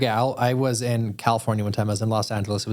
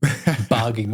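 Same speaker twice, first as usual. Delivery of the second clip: ordinary talk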